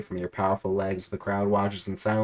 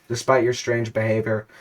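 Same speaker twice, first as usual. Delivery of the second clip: ordinary talk, nothing marked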